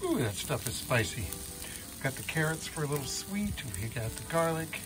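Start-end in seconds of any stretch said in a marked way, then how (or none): none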